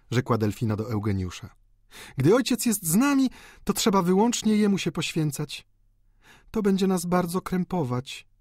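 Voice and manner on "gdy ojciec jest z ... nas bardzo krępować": high-pitched feminine voice; complaining young woman. A narrator's reading. Delfina complaining to Eugeniusz about her father, high-voiced. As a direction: confide a complaint in a much higher voice.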